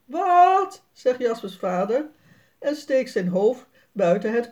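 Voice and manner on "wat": much higher, louder "Wat?"; surprised shout from inside; puzzled call. A narrator's reading. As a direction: call back, not having heard. Dad didn't hear, calls back.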